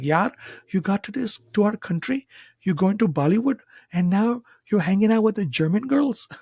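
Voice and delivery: high-pitched, mock Indian schoolboy teasing; bad Indian accent. Schoolboys teasing about Bollywood and girls, bad Indian accent. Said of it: "That's a bad accent".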